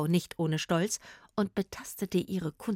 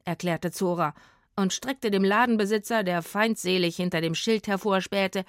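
ordinary delivery

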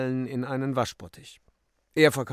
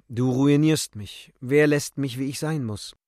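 mild angry accusation; 4 semitones higher, 7 dB louder than usual. Acted. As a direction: accuse her with raised voice. Acted quarrel, his accusation. (lauter, vorwurfsvoller Streitton)

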